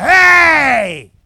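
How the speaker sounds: long, drawn-out high holler; loud, stretched shout for someone far away; yelled call. Retold or imitated. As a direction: bellow up the hill and hold it. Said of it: "I yell it again".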